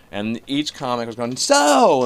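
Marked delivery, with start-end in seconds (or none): 1.4-2.0 very high, loud yell; screaming. Retold or imitated. Comic imitating club comics yelling over a bar crowd, loud. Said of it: "screaming ab-, above the microphone".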